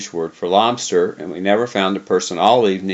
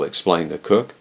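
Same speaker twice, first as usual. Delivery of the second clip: ordinary delivery, nothing marked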